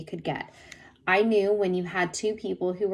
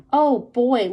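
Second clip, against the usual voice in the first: a loud, higher holler at a squirrel; 5 semitones higher, 8 dB louder than usual. A narrator's reading. Rufus hollers to Kenny about the squirrel, loud. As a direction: call out to grab his attention. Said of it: "hollered out".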